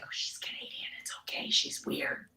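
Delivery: whispered crowd murmur; very soft, reassuring stage whisper; hushed aside. Retold or imitated. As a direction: whisper it like onlookers excusing her. Ballroom crowd murmuring about her.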